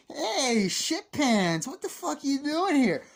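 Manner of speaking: high shouted bully taunt, hammed-up; mock bully yell. Retelling the bully taunting young Michael, high mock yell. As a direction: yell it like a sneering school bully.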